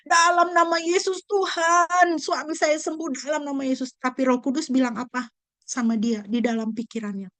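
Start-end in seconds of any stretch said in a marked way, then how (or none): 0.0-3.9 crying out. Retold or imitated. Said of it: "Dia menangis menjerit".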